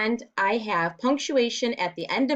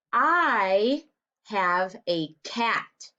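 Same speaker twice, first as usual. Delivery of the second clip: each word slow and separate, first word "I" stressed, rising at the end; slow deliberate reading; much slower than usual. Shown as a demonstration. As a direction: read it aloud slowly, word by word, for young pupils. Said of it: "It says".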